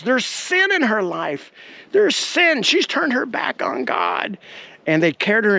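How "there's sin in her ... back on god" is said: high, drawn-out mock denunciation; imitated accusing preacher. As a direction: denounce a sinner like a revival preacher. Quoting healer accusing the woman.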